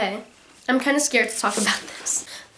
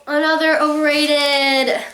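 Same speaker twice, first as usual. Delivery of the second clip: drawn-out verdict; 4 semitones higher, slower than usual. Acted.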